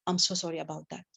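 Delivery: quiet, gentle apology; soft sympathy. Shown as a demonstration. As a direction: say it softly, with care.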